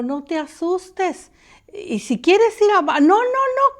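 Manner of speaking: ghost's invitation. Retold or imitated. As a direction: coax gently, then panic. La amiga la invita, ella rechaza. (invitación de la difunta, más aguda)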